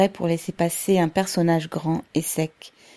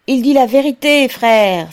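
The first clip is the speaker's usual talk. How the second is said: high, loud endorsement; fervent cry; 7 semitones higher, 9 dB louder than usual. A narrator's reading. Makotine vouches for Pavel to the crowd, crying out high and loud. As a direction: cry out in support. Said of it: "s'écria Makotine".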